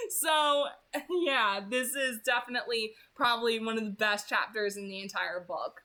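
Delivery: ordinary talk, nothing marked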